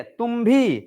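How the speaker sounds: भी hit hard and high; stress on भी. Shown as a demonstration. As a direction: punch the word भी. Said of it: "किस पर जोर पड़ रहा है?".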